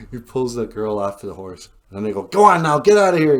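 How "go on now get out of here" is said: loud, raised-pitch acted order; mock angry yell; chasing someone off. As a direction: shoo away an intruder forcefully. Acted Indians chasing him off.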